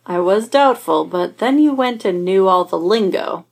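dry deadpan remark. Acted. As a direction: say it dry, unimpressed. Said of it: "Clint says dryly".

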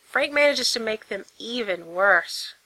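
plain reading voice

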